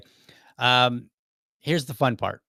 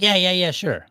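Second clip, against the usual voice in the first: higher-pitched, breezy mock agreement; offhand agreement; 8 semitones higher, 4 dB louder than usual. Manager agreeing to come back and show him, offhandedly. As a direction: imitate someone agreeing too readily. Manager brushes off request for guidance.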